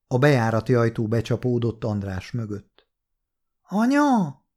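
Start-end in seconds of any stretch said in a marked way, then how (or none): 3.6-4.4 put-on child's high call from down the hall; far-off high child's cry of Mom; little girl calling. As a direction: call out high like a small daughter. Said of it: "Emese hangja".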